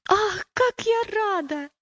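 high, drawn-out cry of delight; storybook girl's delighted exclamation; overjoyed. Acted. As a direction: exclaim it slowly, high with joy. Girl rejoices at brother joining her.

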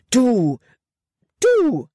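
high, loud, sputtering outrage; furious stammer, pitch leaping up; spluttering fury. A narrator's reading. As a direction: choke on her own anger. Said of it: "sprühte sie".

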